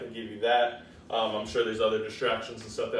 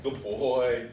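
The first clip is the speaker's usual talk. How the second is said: usual speaking voice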